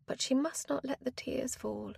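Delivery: usual speaking voice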